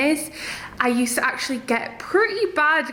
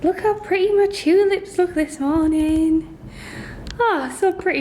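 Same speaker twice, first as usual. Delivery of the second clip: delighted admiring; 6 semitones higher than usual. Real, not acted. Admiring tulips in the morning.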